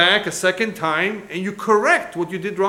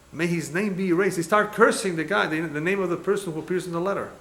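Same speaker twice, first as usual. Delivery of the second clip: ordinary talk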